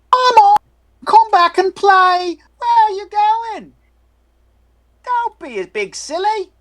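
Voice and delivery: put-on high loud child's plea, slowed; a child calling you back to play; boy yelling to come play. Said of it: "he's yelling at you".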